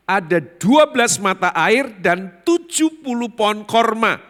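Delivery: loud, high preaching proclamation; forceful scripture reading. Real, not acted. Preacher reading out Elim's twelve springs, triumphantly loud. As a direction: proclaim the verse at full force.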